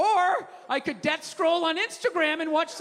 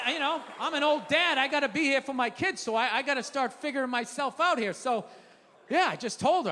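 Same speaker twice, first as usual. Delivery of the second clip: ordinary talk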